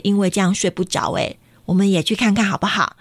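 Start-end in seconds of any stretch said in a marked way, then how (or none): none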